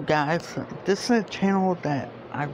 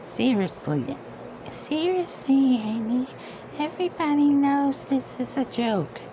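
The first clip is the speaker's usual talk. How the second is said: much higher, drawn-out whiny reading; mock high voice; 10 semitones higher than usual. Reading an annoying comment aloud, whiny high mockery. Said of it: "reading comment in mock voice".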